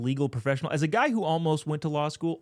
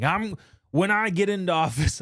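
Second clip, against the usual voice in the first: put-on politician voice, a bit louder; Letitia James voice; 5 semitones higher, 3 dB louder than usual. Vlogger mimicking the attorney general's campaign speech, put-on voice. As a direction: caricature the attorney general making her pledge. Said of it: "I'm still doing the voice".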